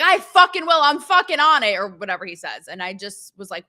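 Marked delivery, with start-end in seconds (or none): 0.0-1.7 higher, louder gung-ho yell; eager shout. Retold or imitated. FBI director answering Trump on speakerphone, gung-ho shout. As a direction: bark it like an excited fan. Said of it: "here's Kash Patel. He's like".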